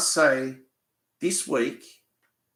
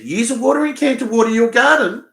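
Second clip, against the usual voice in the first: higher, louder mock of a nagging neighbour woman; 11 semitones higher, 8 dB louder than usual. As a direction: parrot her bossy order back. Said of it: "as she said".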